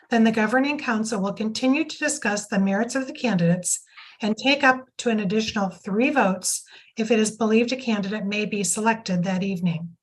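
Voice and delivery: ordinary talk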